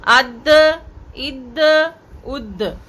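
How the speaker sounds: अद, इद, उद with a bounce on the द; echoed release. Shown as a demonstration. Teacher demonstrating echoed द endings. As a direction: bounce each द. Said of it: "तो कलकला करना ज़रूरी है".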